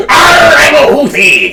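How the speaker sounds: high, loud cartoon buccaneer; hammed-up sea-dog shout; pirate voice. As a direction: growl it out like a storybook pirate. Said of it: "It's a pirate voice".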